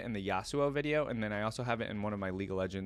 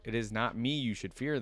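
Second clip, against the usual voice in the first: ordinary delivery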